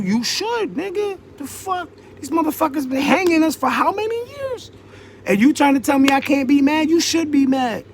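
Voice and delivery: pitched high, fired up and indignant; heated outburst. Real, not acted. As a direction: let the anger surge up high. Heated rant about racial anger.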